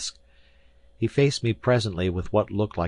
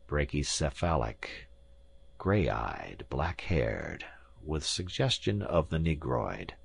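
low appraisal, as if to himself; muttering; 4 semitones lower, 6 dB quieter, slower than usual. A narrator's reading. Professor classifies his visitor's features, muttering. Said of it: "he muttered".

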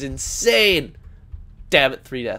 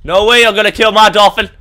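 loud protest; 2 semitones higher, 7 dB louder than usual. Real, not acted. Gamer protesting loudly.

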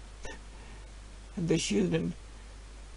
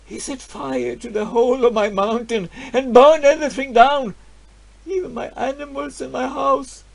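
rising outrage; 8 semitones higher, 6 dB louder than usual. Acted. Narrator recalls burning of his land.

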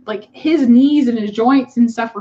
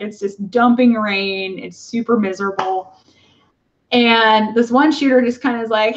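normal talking voice

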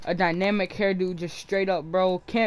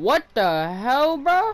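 surprised; 7 semitones higher, 5 dB louder, much slower than usual. Real, not acted. Startled by game audio.

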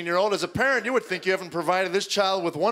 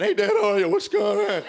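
put-on squeaky, much higher and louder cracking teen voice; 10 semitones higher, 4 dB louder than usual. Teen son greets dad, cracking pubescent voice. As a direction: let the pitch break up high mid-greeting. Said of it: "the prepubescent voice".

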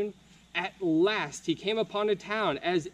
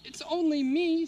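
higher, cheerful Leo voice; bright young knight; 10 semitones higher than usual. Acted. Leo offering the troll his roll, higher and friendly. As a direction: offer kindly, like an eager boy.

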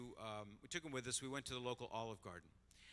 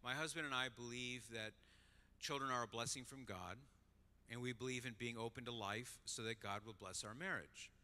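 soft, even, unhurried statement of belief; quiet and simple, with unruffled pauses; very calm; 2 semitones lower, 5 dB quieter than usual. Retold or imitated. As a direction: imitate a gently composed wife. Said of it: "she said in a very calm voice".

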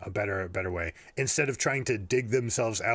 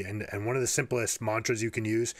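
normal talking voice

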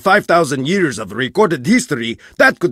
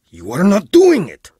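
usual speaking voice

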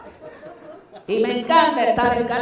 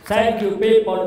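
mock English; 7 semitones higher, 10 dB louder than usual. Performer thanks audience in English.

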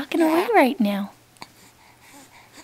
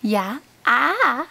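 ordinary delivery

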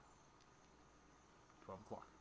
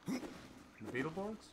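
questioning; 5 semitones higher, 6 dB quieter, much slower than usual. Real, not acted. Puzzled query about the boss.

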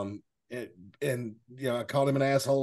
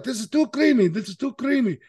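high, raised mimicry of his accent; imitated complaining customer; 8 semitones higher, 5 dB louder than usual. Restaurant guest repeating his sauce complaint to the waitress, high mocking imitation. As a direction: send up a diner's gripe, pitched way up. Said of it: "That's what he sounded like".

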